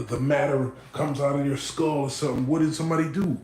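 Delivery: usual speaking voice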